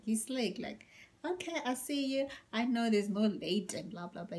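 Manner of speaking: ordinary talk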